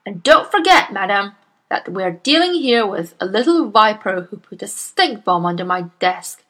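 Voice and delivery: loud, booming bully of a headmistress; Miss Trunchbull. Acted. Bullying headmistress scolds Miss Honey, booming and loud. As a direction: bark at the timid teacher like a tyrant.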